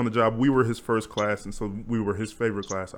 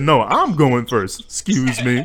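loud, slow mock insistence; playful loud quote; 9 dB louder, slower than usual. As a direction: boom it out like claiming first place. Mock-bossy quote of a friend.

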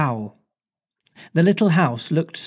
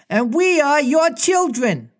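much higher eager twins' cry; twins crying out; 11 semitones higher than usual. Acted. Twins announce themselves to Wendy, high excited cry. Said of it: "cried the twins".

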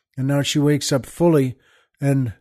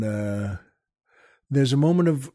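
ordinary talk

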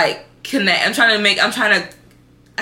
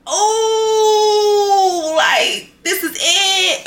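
overwhelmed cry; 12 semitones higher, much slower than usual. Retold or imitated. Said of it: "I was just like".

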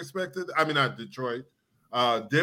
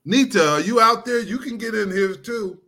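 calling from far away; 10 semitones higher, 5 dB louder than usual. Real, not acted. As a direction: call out loud and high. Calling someone out there.

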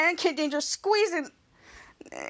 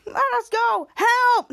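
high-pitched mock plea, louder on "help"; acted cry for help; 7 semitones higher, 3 dB louder, much slower than usual. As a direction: call out as if trapped. Retelling trapped characters calling out.

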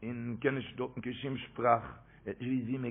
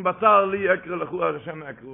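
loud retold cry of prayer; 3 semitones higher, 9 dB louder, slower than usual. Stranded traveller cries out to Hashem, loud outburst. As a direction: call it out loudly, first words hardest. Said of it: "Hat er geschrien".

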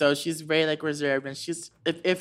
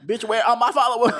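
loud, high comic demand; high, indignant mock shout; mock diva outrage; 9 semitones higher, 9 dB louder than usual. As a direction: playfully yell it over laughter.

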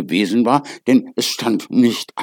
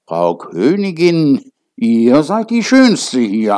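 mirror voice; 5 semitones higher, 6 dB louder, slower than usual. A narrator's reading. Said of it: "Da antwortete der Spiegel".

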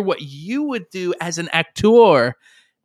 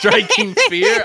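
laughing; 13 semitones higher than usual. Real, not acted. Echoes partner, laughing.

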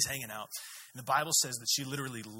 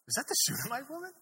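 high, quick, rising question; puzzled double take, pitched right up; acted surprise; 12 semitones higher, faster than usual. Elisha spotting the woman far off, surprised question.

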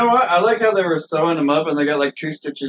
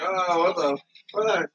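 mock dazed; 5 dB quieter, much slower than usual. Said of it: "he's like".

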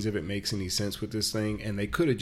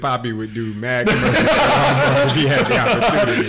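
loud hype; 5 semitones higher, 11 dB louder than usual. Real, not acted. Hyped rant about Walmart sponsorship.

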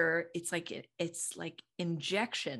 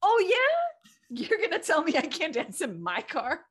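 much higher, louder mock outrage; Dragon Mom; 10 semitones higher, 7 dB louder than usual. Mom scolding kids who ban her car dancing, mock outraged. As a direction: play the indignant dragon mom. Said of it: "I would get so Dragon Mom on them".

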